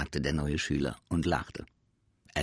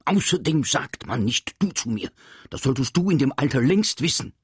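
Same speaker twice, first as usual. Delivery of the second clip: teacher scolding; 7 semitones higher, 7 dB louder than usual. Acted. Angry teacher scolds pupil.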